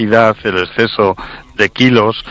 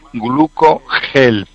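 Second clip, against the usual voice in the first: slow and deliberate; 3 semitones higher, much slower than usual. Real, not acted. Announcing product name to listeners.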